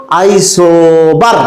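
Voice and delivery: আইসোবার drawn out slowly with a push on বার; Arabic qalqala style. Shown as a demonstration. Teacher demonstrating আইসোবার chanted with a bounce on বার. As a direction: stretch আইসোবার and knock out the last syllable. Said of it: "এইখানে একটা ধাক্কা".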